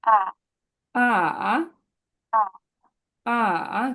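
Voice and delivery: আ dipping then rising; falling-rising আ; third tone. Shown as a demonstration. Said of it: "নিচে নেমে উপরে যাবে".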